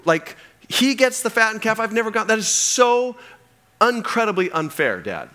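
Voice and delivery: high, loud, fast protest of a wronged son; whiny indignant son. Acted. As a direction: whine it like a child crying foul. Older son whining complaint, acted.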